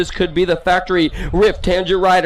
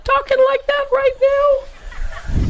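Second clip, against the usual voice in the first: much higher, slow, laughing mockery; mock squeaky voice; much slower than usual. Announcer teasing a rider by mocking a squeaky voice.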